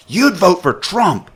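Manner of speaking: high, drawn-out mock accusation; retold loud, accusing voice; imitating loud accusation. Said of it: "And now she's loud".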